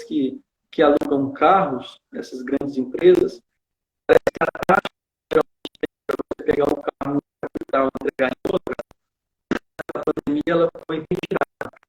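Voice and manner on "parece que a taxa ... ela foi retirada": breaking up over a bad internet call; choppy call audio. Real, not acted. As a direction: voice dropping in and out on a weak connection. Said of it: "tá cortando só".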